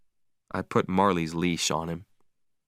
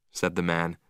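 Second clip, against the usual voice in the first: plain reading voice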